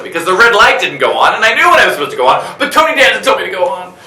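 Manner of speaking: high, loud, hammed-up complaint; loud, worked-up mock outrage; exasperated protest. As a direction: protest your innocence at full volume. Exasperated self-defence in retold mishap.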